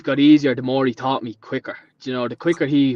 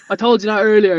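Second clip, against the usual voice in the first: higher, louder mock protest; mock exasperated; 8 semitones higher, 7 dB louder than usual. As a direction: insist you already said it, exasperated. Arguing with half-deaf boss.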